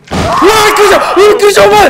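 loud, high startled shout; real fright. Real, not acted. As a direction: yell in genuine shock at the enemy. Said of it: "ちょっと今めっちゃびっくりしたわ". (本気で驚いた叫び)

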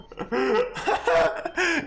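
high burst of laughter; real high-pitched laugh. Real, not acted. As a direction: crack up helplessly. Laughs at the game.

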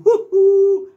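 very high, loud squeal; high-pitched "ju ju" yelp; squeaky mouse cry. Shown as a demonstration. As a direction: shriek like a cartoon mouse. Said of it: "Así se presenta el ratón Jabidú".

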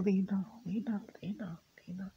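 soft soothing whispered name. Real, not acted. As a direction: hush her gently by name.

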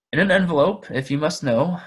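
impatiently. A narrator's reading. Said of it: "said Bilbo impatiently".

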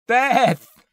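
very high, loud scream; terrified cry. A narrator's reading. Man pinned by thing cries for wife, terrified. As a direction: cry out the name in terror. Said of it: "he cried".

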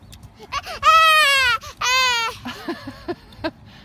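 loud delighted laugh. Real, not acted. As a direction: burst out laughing. Laughing at toddler.

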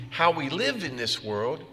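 ordinary delivery

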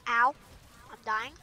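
ordinary talk

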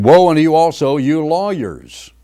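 thundering pulpit woe; preaching scripture. Acted. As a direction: lean on woe and drag out lawyers. Christ's rebuke read out.

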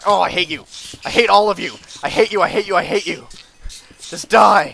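loud whiny rage, rising in pitch; angry crying. Real, not acted. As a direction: wail it out in frustration. Swarmed by silverfish, raging.

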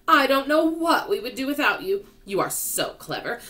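grateful praise. Acted. Old grasshopper praises James, grateful praise. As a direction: gush with appreciation.

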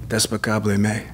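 DESPICABLE said as "des-puh-COB-lay", ME as "may"; deadpan misreading of Despicable Me as "des-puh-cob-lay may"; Theo Von bit. Retold or imitated. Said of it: "that, uh, Theo Von does".

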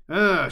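high, loud "ugh"; recoiling cry as something flaps past; cry of disgust. A narrator's reading. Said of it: "she cried as it flapped past".

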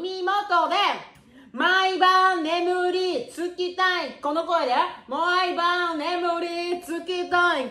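high, long drawn-out wail; playful swoon in a slow, high wail; fangirl swooning. Pretend. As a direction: stretch every word out slowly, high and adoring. Swoons over the singer's voice.

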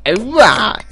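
a failed, lightly voiced Quagmire cheer; half-hearted cartoon cheer; a hint of Quagmire from Family Guy; Quagmire impression. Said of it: "that was my failed Quagmire impression".

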